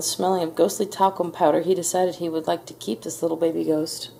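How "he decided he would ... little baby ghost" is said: usual speaking voice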